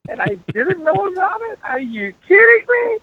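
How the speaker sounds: hammed-up squeal of outrage; mock incredulous. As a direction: act out a shocked dad, voice shooting way up. Retold father reacting to boyfriend news.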